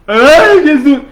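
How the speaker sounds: high, loud gasp of "Ai, Jesus!"; a hammed-up startled cry before dropping dead; mock startled cry. Preacher acting a believer who meets Jesus, mock startled cry. Mimics someone seeing Jesus, dying.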